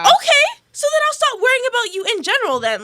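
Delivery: high-pitched, loud indignant retort; exasperated comeback. Retold or imitated. Her exasperated comeback to retold guy.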